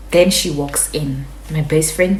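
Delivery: ordinary talk, nothing marked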